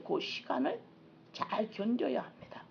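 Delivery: hushed whisper, breath only. Real, not acted. 회중에게 두려움을 견디라고 다독이며 속삭임. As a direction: lean in and whisper the encouragement. Intimate encouragement to congregation.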